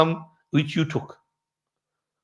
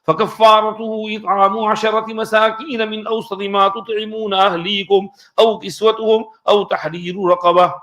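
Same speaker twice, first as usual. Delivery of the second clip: fast, higher chanted recitation of the verse; Quran recitation; 3 semitones higher, much faster than usual. Acted.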